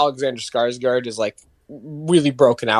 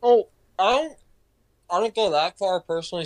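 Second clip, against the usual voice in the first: usual speaking voice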